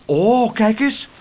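high, drawn-out cry of wonder; calling out. A narrator's reading. Laura points out meneer Tump to the others, calling out. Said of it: "riep Laura".

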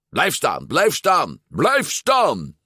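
high repeated order, climbing in pitch; frantic command. A narrator's reading. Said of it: "beval Alberto". (dringend bevelend)

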